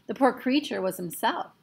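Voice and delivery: ordinary delivery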